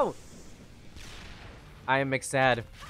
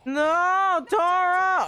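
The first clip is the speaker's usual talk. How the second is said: high loud cry of the name; dismayed shout; 5 dB louder, much slower than usual. Real, not acted. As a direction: call out in alarm. Ally falls.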